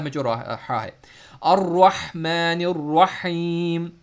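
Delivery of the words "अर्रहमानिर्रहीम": अर्रहमानिर्रहीम slow with a throaty middle-throat ha; tajweed-style slow recitation; Arabic recitation. Shown as a demonstration. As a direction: recite अर्रहमानिर्रहीम stretched and guttural. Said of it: "हा middle throat से पढ़ें".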